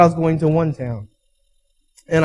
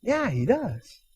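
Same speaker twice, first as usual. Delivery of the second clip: quiet thought; 11 dB quieter, much slower than usual. Retold or imitated. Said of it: "I thought".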